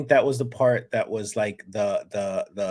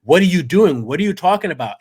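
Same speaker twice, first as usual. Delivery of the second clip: alarmed outburst; 6 semitones higher, 7 dB louder, faster than usual. Retold or imitated. Vidi objects to her speech.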